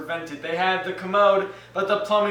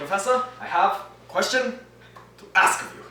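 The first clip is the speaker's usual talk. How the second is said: put-on German accent; faster than usual. Student asking again in a German accent. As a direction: repeat the question in a German accent. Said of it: "You've stolen my German accent".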